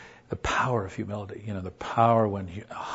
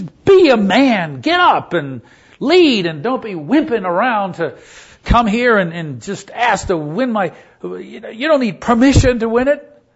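loud, high, furious scolding; irate shouting in a raised pitch; angry berating; 11 semitones higher, 13 dB louder than usual. Retold or imitated. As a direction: chew him out at full volume. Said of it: "got this angry face".

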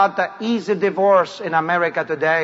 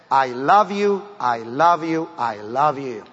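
God's tender whisper fading; 7 semitones lower, slower than usual. Acted. God speaking tenderly to listeners.

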